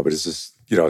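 usual speaking voice

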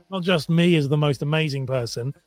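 ordinary talk, nothing marked

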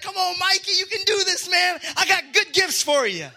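high, urging cheer; acted eager encouragement. God urging Mikey on with good gifts waiting, acted encouragement. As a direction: cheer him on like an eager parent. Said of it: "No, it's like".

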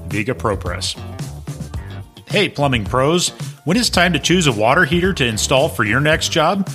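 ordinary delivery

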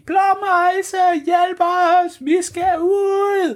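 high shouted plea; calling for help. Acted. Kaj and Bamse shouting to the bluetit, high and frantic. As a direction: yell up the stairs in a panic. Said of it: "der råber de".

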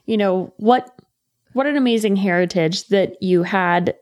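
ordinary talk, nothing marked

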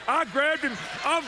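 high, loud, breathless-with-joy storytelling; hammed-up excited shouting; re-enacted elation. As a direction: burst out as if overjoyed. Overjoyed retelling of hugging the man.

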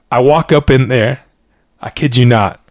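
normal talking voice

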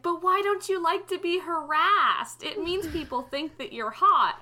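high, mocking imitation; mock incredulous question. Mimicking the commenter, mock question.

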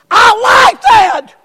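high, drawn-out shout of approval; booming approval. Acted. Preacher voicing God's answer to the prayer, booming approval. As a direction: shout it out slowly, high and loud.

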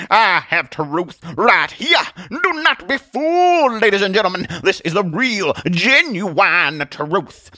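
high, loud, slow hawker's proclamation; revival-tent barker, words stretched out; Atheist as showman preacher. Acted. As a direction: present it grandly to ladies and gentlemen.

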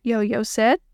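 ordinary delivery

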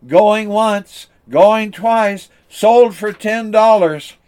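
auctioneer's closing call. Acted. Auctioneer closing the sale loudly, slowing at the end. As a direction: drive home the sale.

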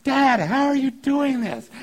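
put-on high, loud, slow child's wonder; amazed kid. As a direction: play the thrilled kid dangling by the arms. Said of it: "easily excited and amazed".

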